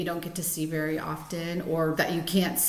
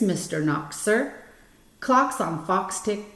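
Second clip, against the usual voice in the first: put-on reading to children; 3 semitones higher than usual. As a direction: read each rhyme out carefully for the kids. Reads Fox in Socks aloud.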